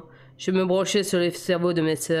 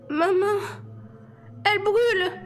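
cri de douleur. A narrator's reading. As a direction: cry out to her mother as the burn hits. Bella blessée crie de douleur. (Bella blessée crie sa mère, voix très aiguë)

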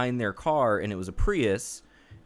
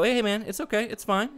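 placating; 11 semitones higher than usual. Retold or imitated. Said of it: "I go".

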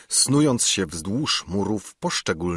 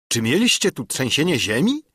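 higher-pitched, mildly vehement question; vehement exclamation; 6 semitones higher, 3 dB louder than usual. A narrator's reading. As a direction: burst out in disbelief, not fully shouted. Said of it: "zawołał stryj gwałtownie". (gwałtowny okrzyk)

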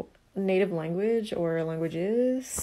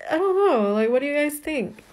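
laughing; 6 semitones higher, 6 dB louder than usual. Real, not acted. Laughing after her demo.

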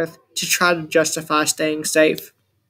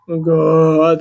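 zombie groan; 4 dB louder than usual. A narrator's reading. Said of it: "the zombie groaned".

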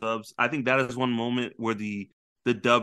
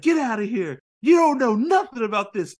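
high, loud mock yell, laughing; furious Nami; 10 semitones higher, 6 dB louder than usual. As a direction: chase him off, shouting. Nami yells at Luffy, retold.

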